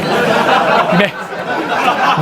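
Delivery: breathy unvoiced laugh fit; laughing at the joke; laughter. Real, not acted. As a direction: burst out laughing.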